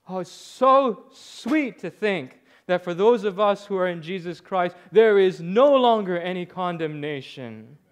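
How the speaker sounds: high, loud, impassioned proclamation; full-force preaching. Real, not acted. Pastor tells congregation what God does to hearts, full-force preaching. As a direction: stretch the key words and drive them out to the crowd.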